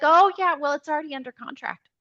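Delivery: imitating a breezy agent on a call; acted agent's reply. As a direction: toss off bad news, loud start then quick. Acting the agent's offhand reply.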